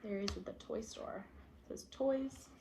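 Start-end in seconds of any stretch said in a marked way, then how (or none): none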